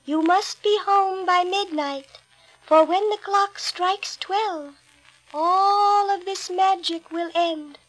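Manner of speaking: high, slow solemn warning; fairy godmother. A narrator's reading. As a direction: warn her gently, very slow. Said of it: "godmother warns Cinderella".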